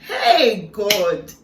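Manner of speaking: loud, real exclamation to God; overcome outcry. Real, not acted. Overcome by the memory of God's love, crying out. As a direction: burst out loudly, overwhelmed.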